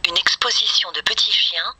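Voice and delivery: ordinary delivery, nothing marked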